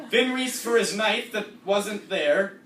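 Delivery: normal talking voice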